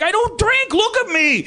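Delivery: high, affronted big guy; thick-accented boxer. Retold or imitated. Boxer turning down a drink from the group, high and affronted. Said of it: "really thick, uh, accent".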